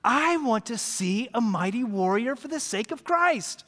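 usual speaking voice

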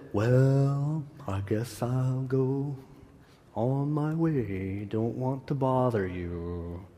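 slow, soft, droopy donkey voice; Eeyore. Retold or imitated. Preacher imitating Eeyore to his congregation, slow and glum. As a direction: mope it out like a gloomy donkey who doesn't want to bother anyone. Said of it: "Eeyore is the one that always says".

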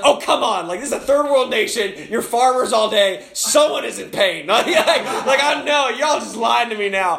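high, loud, incredulous protest; exasperated. Retold or imitated. Preacher to villagers who all deny having pain, exasperated. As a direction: plead with them in comic disbelief.

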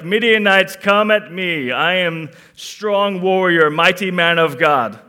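loud, puffed-up warrior swagger, slowing on strong warrior; hammed-up brave challenge shouted at the enemy; mock bold boast. Gideon imagined taunting the Midianites, mock heroic shout. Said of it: "He wasn't boldly standing out there like".

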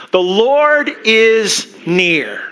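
The Lord is near, each word slow and weighty; slow, drawn-out declaration. Shown as a demonstration. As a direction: let "Lord" ring out slowly. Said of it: "Can you say that with me".

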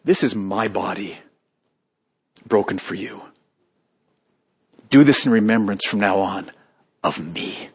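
low, hushed and reverent; solemn Jesus quote. Acted. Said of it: "what did he say at that meal".

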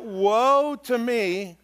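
slow lament woe to me; drawn-out woe. Shown as a demonstration. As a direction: stretch woe to me out dramatically.

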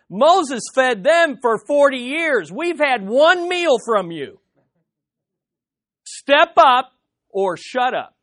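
loud, high, acted-out indignation; imitated crowd protest, pushed high and loud; indignant crowd challenge.

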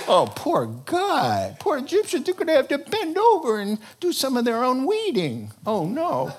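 high, sarcastic pity; hammed-up sympathy; mock pity.

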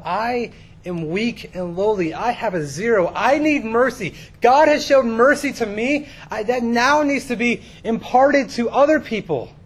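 slow, loud, high preaching that builds; impassioned preaching. Real, not acted. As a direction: build to a forceful sermon declaration. Preaches at rising force.

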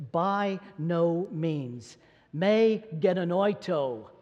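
BY NO MEANS very slow, each word weighted; emphatic no. Retold or imitated. Preacher quoting Paul's answer to the church, emphatic no. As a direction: spell out "By no means" slowly and firmly. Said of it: "It is the emphatic no".

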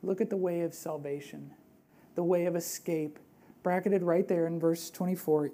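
ordinary talk, nothing marked

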